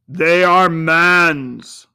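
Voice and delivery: solemn spirit. A narrator's reading. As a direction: weigh each word like a judgment. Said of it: "said the spirit".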